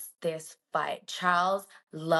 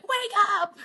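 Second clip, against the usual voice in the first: much higher, a shouted "Wake up!"; mock wake-up yell; 13 semitones higher than usual. Friend imagines calling her co-host awake over the news, shouted. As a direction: yell it like you're rousing a sleeping friend. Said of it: "and I would have been like".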